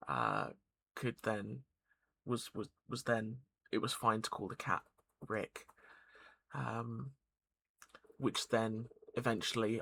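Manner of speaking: ordinary talk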